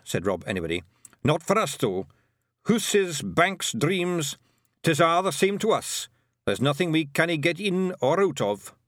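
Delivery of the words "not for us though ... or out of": high, cocky Scots brogue; a proud wee Feegle warrior; broad Scots Feegle; Scottish pixie voice. A narrator's reading. Rob Anybody's Feegle voice.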